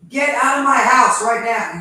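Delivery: furious shout; shaking with anger. Real, not acted. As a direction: yell it in real rage. Real angry confrontation at home.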